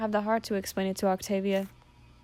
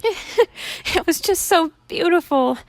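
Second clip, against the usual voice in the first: high, loud, very slow crying wail; happy sobbing; 9 semitones higher, 7 dB louder, much slower than usual. Acted. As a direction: sob it out overjoyed. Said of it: "sobbing harder".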